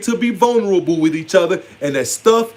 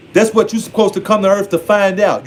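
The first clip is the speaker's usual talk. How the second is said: usual speaking voice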